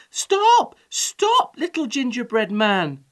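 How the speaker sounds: high, loud calls to stop, then easing; crying out. A narrator's reading. Old woman calls after the runaway gingerbread man, crying out. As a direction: call sharply after a runaway. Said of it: "cried the old woman".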